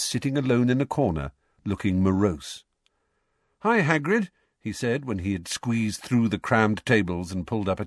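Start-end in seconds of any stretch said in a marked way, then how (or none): 3.6-4.3 Harry greeting. A narrator's reading.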